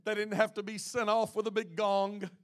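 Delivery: ordinary talk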